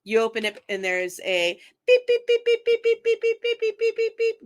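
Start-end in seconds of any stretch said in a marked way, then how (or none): 1.9-4.4 rapid high electronic beeping; loud monotone beep-beep on one high note; imitated door-chime beeps. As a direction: mimic a shop's entry alarm with quick, even, high beeps. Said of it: "alerting that there, somebody has come".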